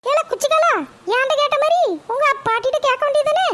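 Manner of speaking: high-pitched mother fuming about her son; shrill-pitched scolding mum, fed up; annoyed mother. Acted. Mother complains to grandma about her son, high and irritated.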